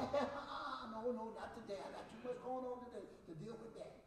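soft, low mock refusal, winding down; dismissive brush-off. Preacher imitating someone softly brushing off drama. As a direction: wave them off quietly, done with it. Shutting down a difficult person.

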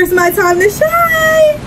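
high, slow, cracking shout of triumph; hyped shout. Acted. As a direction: yell it out like a hero's moment. Hyped up sneaking out.

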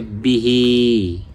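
বিহি with short বি and long-held হি; quick বি, then stretch out হি; elongated ending. Shown as a demonstration. Quran teacher showing the madd, বিহি with a drawn-out last vowel. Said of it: "মাদের হরকত একালিপ".

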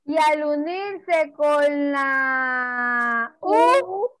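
very slow, every word stretched; drawn-out prompt. Shown as a demonstration. Teacher to young pupils, slowly drawing out the question. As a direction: drag the prompt out for the kids.

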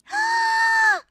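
sharp high gasp of shock; surprised gasp. Real, not acted. Listener hearing her own voice, a loud gasp. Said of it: "was that me?".

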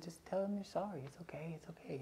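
hushed whisper at bedside. Retold or imitated. Mother whispering comfort to crying daughter at bedtime. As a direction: whisper it softly to a crying child. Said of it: "I remember I just said".

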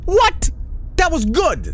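high, loud disbelieving what; indignant surprise. Real, not acted. Gamer outraged the game failed his attempt, loud and high. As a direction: protest that it was good.

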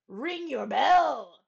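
called out. Acted. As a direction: call up to the bell ringer. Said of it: "he called".

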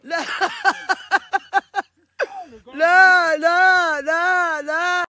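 high, hammed-up wailing no; mock wail. Friend wailing no at the chicken being ruined.

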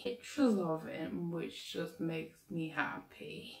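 slow, soft, lovestruck repeat; dreamy swoon. Acted. To her viewers about her crush Marco, slow dreamy swoon. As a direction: sigh it out like you're daydreaming about him.

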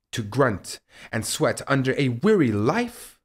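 ordinary talk, nothing marked

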